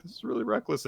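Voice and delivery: acted hesitant protest; questioning aside. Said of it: "turns to Nue questioningly".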